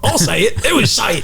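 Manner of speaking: a hint of a put-on Australian accent; mock Australian. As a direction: copy the Aussie voice for a quick jab. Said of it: "with Nanette voice".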